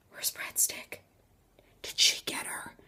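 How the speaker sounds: soft, spaced-out whisper; frightened whisper. Retold or imitated. Teller asking after missing Breadstick, frightened whisper. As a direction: whisper as if the ghost might hear. Mock fear for missing friend.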